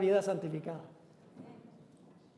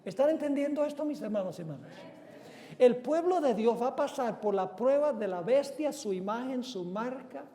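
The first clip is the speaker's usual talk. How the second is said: ordinary talk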